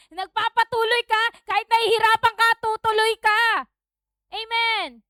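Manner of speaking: high, loud exhortation; full-force preaching. Real, not acted. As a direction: urge the congregation to keep going.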